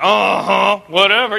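mock dismissive uh-huh, whatever; wedding guests scoffing at submission, dismissive; skeptical brush-off. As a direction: roll your eyes as you say it. Women in the room reacting.

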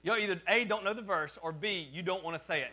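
usual speaking voice

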